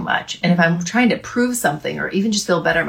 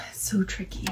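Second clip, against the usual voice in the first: breathy sigh, almost no voice; sighing whisper; 13 dB quieter than usual. Real, not acted. Sighed agreement after hard topic.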